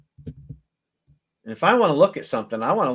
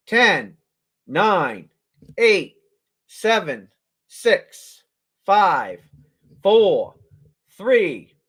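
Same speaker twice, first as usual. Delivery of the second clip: punchy, louder countdown, each number hit; 3 semitones higher, 7 dB louder, much slower than usual. Real, not acted. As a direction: bark out the countdown to the bidders. Auction countdown called out.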